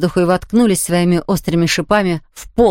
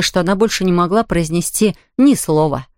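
ordinary delivery

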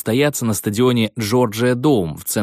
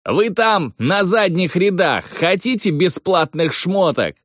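calling out; 8 semitones higher than usual. Retold or imitated. Calling out to back rows.

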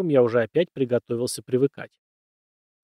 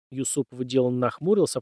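usual speaking voice

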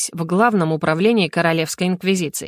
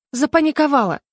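higher-pitched, sighed confession; sighing admission; 7 semitones higher than usual. A narrator's reading. Heroine confesses panic to the inquisitor, sighing. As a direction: admit it with a light sigh. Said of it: "вздохнула я".

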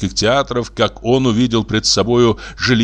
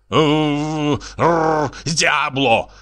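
rolled growling curse; exasperated snarling rage; furious growl; 5 semitones higher, much slower than usual. Acted. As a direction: growl twice, then burst into the oath. Painter growls and curses in fury.

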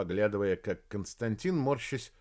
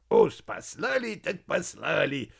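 snorting laughs; 5 semitones higher, 3 dB louder, slower than usual. Acted. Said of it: "издал смешок Константин".

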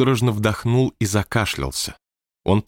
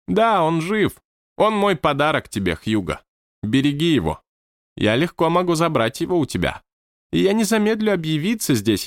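lightly raised, stately manager; high manager voice; 6 semitones higher than usual. Acted. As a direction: speak as the odd high-voiced boss. Manager speaks. (высокий голос руководителя)

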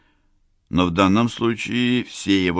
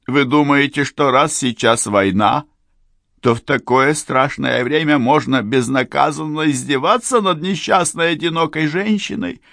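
higher, louder furious accusation; menacing tirade; 10 semitones higher, 4 dB louder than usual. Acted. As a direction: berate the man who betrayed her. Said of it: "грозно сверкая фиалковыми глазами".

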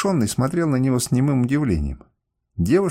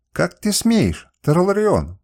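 higher, louder furious cry; enraged princess shouting at the captain; angry shout; 6 semitones higher, 3 dB louder, much slower than usual. A narrator's reading. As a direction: hurl it at him in rage. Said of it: "крикнула она".

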